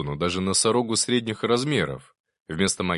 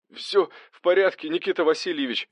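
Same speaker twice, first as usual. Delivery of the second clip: high, strained reply, winded; puffed from exertion; breathing heavily; 9 semitones higher than usual. A narrator's reading. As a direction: answer high while short of breath. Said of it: "тяжело дыша отозвался Арсений".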